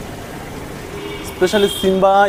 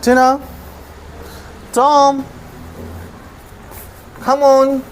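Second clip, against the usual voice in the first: high-pitched call to pets; real calling out, raised pitch; 7 semitones higher, slower than usual. Real, not acted. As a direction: call them over to eat, pitched up. Calling the animals to eat.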